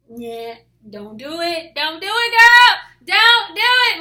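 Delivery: building from normal to a high shout; loud urgent warning. Acted. Friends and family telling her not to, escalating shout. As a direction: escalate into a hammed-up, high-pitched yell. World urging her, against calm God.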